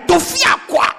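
high, emphatic shout of "Tofiakwa"; Nigerian-style rejection. Shown as a demonstration. Showing how Nigerians say God forbid, shouted rejection. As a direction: cry out "Tofiakwa" as a refusal. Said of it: "That's how they say it in Nigeria".

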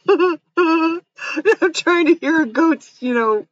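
real laughter takes over; laughing. Real, not acted. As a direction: voice breaking up with laughter, high and slow. Cracking up at goat accents.

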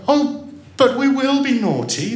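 put-on high, loud childish wail; despairing. A narrator's reading. Children promising the girl to be naughty, despairing. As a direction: plead desperately, pitched right up. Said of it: "they said in despair".

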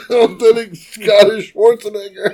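high-pitched, giggling through the words; cracking up while talking; laughing. Real, not acted. Co-host tickled by the Scottish Schwarzenegger, laughing. Laughing at his own impression.

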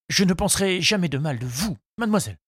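high, earnest suitor's voice; earnest flustered suitor. A narrator's reading. Charles reassures Henriette after the kiss, earnestly flustered. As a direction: protest devotion in a high voice.

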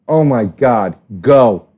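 ordinary talk

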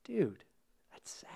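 whispered, moved reaction; hushed sadness. Retold or imitated. Film fan reliving the journey's end, hushed and sad. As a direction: barely get it out, almost no voice. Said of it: "you're just like".

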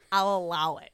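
loud, commanding mistress voice; getting into it. Retold or imitated. Mistress granting her client's request, loudly in character. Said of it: "Then I get into it. I'm like".

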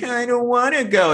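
put-on high whiny kid voice; mock childish whine; mocking a little kid. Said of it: "like you would with a little kid".

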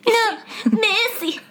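put-on high wailing child's cry; creepy twin voice. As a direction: shriek it like a haunted child. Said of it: "how the two twin children pronounce words".

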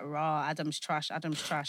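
low, slow, muttered dismissal; low scoffing mock. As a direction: drone it like a bored crowd. Mocking what everyone said.

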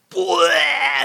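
loud, high retching "bleh"; vomiting noise. Retold or imitated. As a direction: imitate a kid throwing up. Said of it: "puts both of his hands on the counter and goes".

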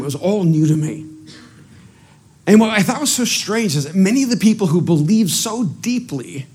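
ordinary talk

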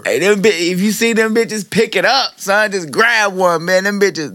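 loud hyped raving; frat bro. Real, not acted. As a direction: rave about it, pitched high and loud. Urging everyone to buy them.

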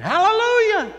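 very high, very loud joyful shout; shouted hallelujah. Retold or imitated. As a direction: throw it out to the rafters. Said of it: "he raised his hand and shouted".

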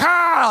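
high, loud yell in sympathy; mock upset scream. Pastor screaming along with little girl, mock upset. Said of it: "both of us are screaming".

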